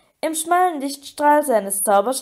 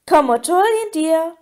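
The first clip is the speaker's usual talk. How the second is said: higher, louder teasing call; gloating taunt; 5 semitones higher, 5 dB louder than usual. A narrator's reading. Mermaid dangles the broom over Harry, gloating taunt. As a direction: taunt him with mild malice. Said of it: "gickelte sie hämisch".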